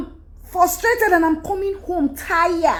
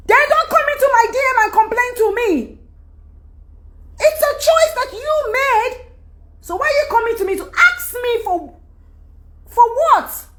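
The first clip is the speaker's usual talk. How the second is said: higher, sharper telling-off; exasperated scolding; 9 semitones higher than usual. Real, not acted. Vlogger scolding women in her DMs, exasperated. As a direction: scold them, fed up.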